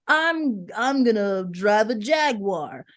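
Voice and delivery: slow, mock-grand declaration; mock affirmation. Mocking empty manifesting, slow and grand. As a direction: overplay a hollow affirmation. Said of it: "being like".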